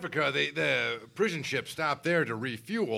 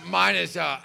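normal talking voice

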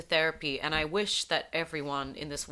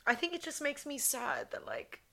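tearful whisper; 6 semitones higher, 6 dB quieter than usual. Real, not acted. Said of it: "why am I crying".

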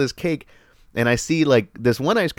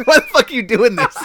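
laughing protest; 9 semitones higher than usual. Real, not acted. Laughing at coworker exposing him.

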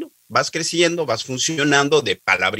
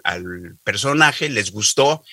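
ordinary delivery

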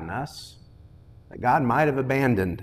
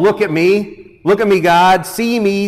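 loud, raised cry to God; heartfelt plea; 7 semitones higher, 7 dB louder than usual. Acted. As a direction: plead urgently to be seen. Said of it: "Pleas from the heart".

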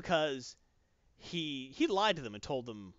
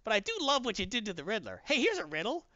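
high, playful mock-villain voice, rising as it goes; Joker impression; 9 semitones higher than usual. Host voicing the Joker taunting the bound Comedian, high mocking tease. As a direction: tease like a giggly Joker telling a riddle. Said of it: "And Joker's like".

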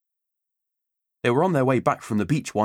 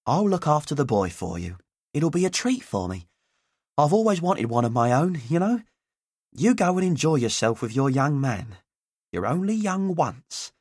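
put-on higher, doting old auntie voice; fond Auntie Ivy; 5 semitones higher than usual. A narrator's reading. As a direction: speak as a fond childless aunt.

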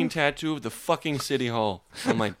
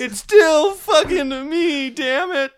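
mock voice; 6 dB louder, slower than usual. Mocking co-host's line.

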